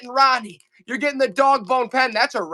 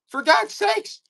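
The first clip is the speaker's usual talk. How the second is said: high, squeaky cry of exasperation; exasperated cry; 11 semitones higher, slower than usual. Acted. Seller despairing that the chat won't bid, high exasperated squeak. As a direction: plead in mock despair, pitched way up.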